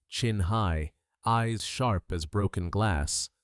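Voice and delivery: usual speaking voice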